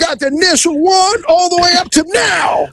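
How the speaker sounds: high-pitched indignant mock bragging; defensive boasting. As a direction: imitate him insisting on his collection's worth. Retold collector's boast, protesting.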